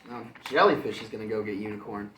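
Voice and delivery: usual speaking voice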